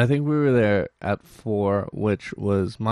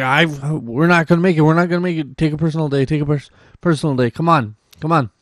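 higher, louder, urgent begging; panicky pleading; 6 semitones higher, 5 dB louder, faster than usual. Retold or imitated. Urging girlfriend to skip work, panicked and high. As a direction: plead with her to just take the day. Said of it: "I'm freaking out for my girlfriend".

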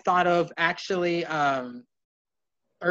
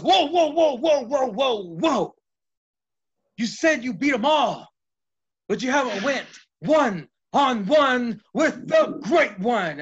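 higher, louder wrestler promo voice; The Rock impression; 8 semitones higher, 5 dB louder than usual. The wrestler mocks Michaels' bragging, loud high promo voice. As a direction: cut him off like a cocky showman. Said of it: "He goes".

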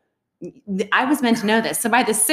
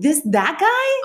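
shocked disbelief; 8 semitones higher, much slower than usual. Retold or imitated. Shocked retort to nail tech.

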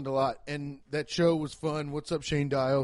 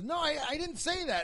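much higher, softer, squeaky imitation; flustered audience guy; 11 semitones higher, 5 dB quieter than usual. Audience member denying to the comic, squeaky imitation. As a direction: squeak out a nervous denial. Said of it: "the guy goes".

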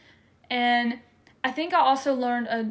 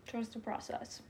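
much softer, settling the words down gently; softly spoken motto; 14 dB quieter than usual. Real, not acted. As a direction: let it fall quietly like a closing amen. Soft closing line of testimony.

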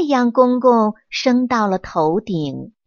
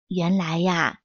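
usual speaking voice

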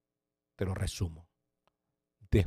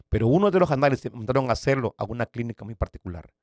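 ordinary delivery

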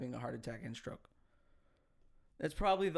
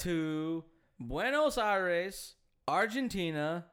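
rolling Buenos Aires in Spanish style, slow and loud; Spanish accent; 4 semitones higher, 6 dB louder, slower than usual. Shown as a demonstration. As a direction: say it the Spanish way, drawn out. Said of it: "I could do a Spanish accent better than that".

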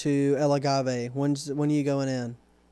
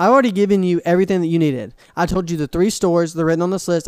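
louder, fast, scolding the customer; retold angry scolding; 6 dB louder, faster than usual. Clerk scolding rude customer about paint stores, heated fast scolding. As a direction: lay into him, rapid and firm. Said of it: "S1 laughs".

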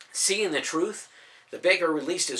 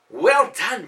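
delighted praise; 8 semitones higher, 10 dB louder than usual. Acted. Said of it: "quietly commented with delight".

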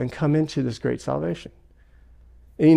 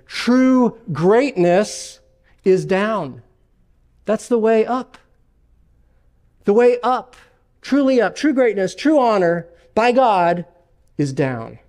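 emphatic build; 5 semitones higher, slower than usual. Real, not acted. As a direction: build it up with fervour. Preacher rising to emphatic climax.

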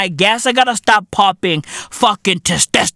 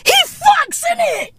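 a very high, put-on voice of awe; mock starstruck squeal; impressed exclamation. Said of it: "I was like".

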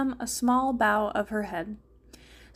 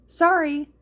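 raised, louder called apology; calling out; 5 semitones higher, 6 dB louder than usual. A narrator's reading. Pilot leaning out to the people below, calling out. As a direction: call it out higher and louder. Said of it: "Namine called out".

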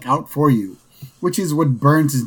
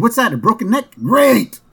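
higher, gleeful ambulance-chaser, louder on great; eager lawyer; 9 semitones higher, 3 dB louder than usual. Retold or imitated. Lawyer chasing a gurney, gleeful and high. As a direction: pounce on a new client with glee. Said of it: "we hear him saying".

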